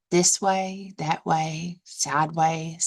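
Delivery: usual speaking voice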